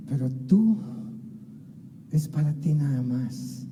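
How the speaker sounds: soft, low and tender; whisper-close, as God inviting one alone. Pretend. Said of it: "Que Dios diga".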